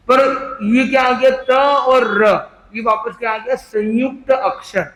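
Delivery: ordinary talk, nothing marked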